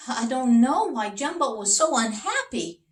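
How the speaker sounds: the king, baffled; puzzled king. Acted.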